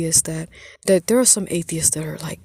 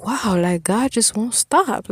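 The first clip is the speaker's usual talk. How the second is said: retold amazement, higher on "won't stop"; amazed; 5 semitones higher, 4 dB louder, slower than usual. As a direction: marvel at it, stretching the words slowly. Retold amazed reaction to God.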